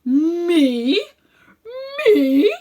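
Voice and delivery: indignant disbelief at the question; scandalised old uncle crying out; offended exclamation. Acted. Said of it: "he exclaimed".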